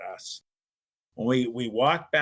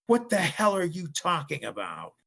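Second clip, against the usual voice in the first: loud, high-pitched outraged outburst; raised angry yell from the next room; screaming dad, retold; 7 semitones higher, 6 dB louder than usual. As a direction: imitate a father shouting in disbelief. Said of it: "I hear my dad scream".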